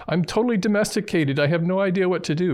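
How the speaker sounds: very high, whiny meowing cat voice; freaking-out cat. Retold or imitated. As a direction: plead for help as a panicked house cat. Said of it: "He's freaking out".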